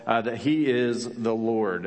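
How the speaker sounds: slow, weighty stress on he; emphatic slow. Acted. Preacher naming Jesus as Lord, slow and emphatic. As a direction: lean on each word, slowly.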